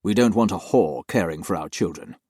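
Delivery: loud, cold and condemning; harshly. Acted. Master dismisses the nanny in disgrace, harsh and loud. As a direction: pass judgement without mercy. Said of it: "he said harshly".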